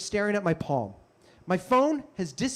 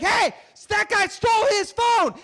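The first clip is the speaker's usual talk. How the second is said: crowd shouting; 12 semitones higher, 3 dB louder than usual. Retold or imitated. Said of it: "People are like".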